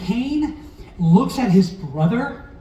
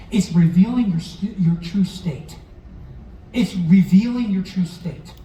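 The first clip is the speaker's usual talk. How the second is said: normal talking voice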